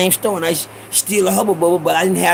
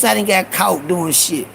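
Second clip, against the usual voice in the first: ordinary talk, nothing marked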